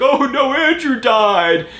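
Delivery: high hammed-up grieving mom; mock grieving mom. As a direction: ham up a tragic mother. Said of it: "she was like".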